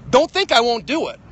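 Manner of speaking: loud, high warning; angry threat. Real, not acted. Threatening the comedian who bugs him, loud angry warning. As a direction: bark the threat.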